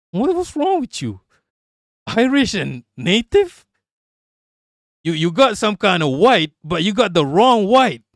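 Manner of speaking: loud, high-pitched incredulous yelling; excited disbelief. Real, not acted. Streamer yelling at a viewer's Irish-and-native mix, in excited disbelief.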